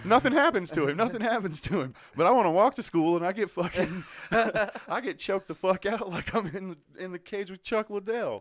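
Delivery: loud, high indignant rant with laughs; exasperated, loud complaint; comic outrage. Real, not acted. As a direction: protest the unfairness at full volume, half laughing. Mock outrage at unfair double standard.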